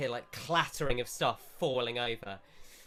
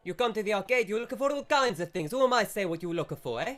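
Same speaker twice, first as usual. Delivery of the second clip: louder, higher, fast mock Italian shopkeeper; shopkeeper accent; 7 semitones higher, 5 dB louder, faster than usual. Shopkeeper brushes off customer, louder fast mock Italian accent.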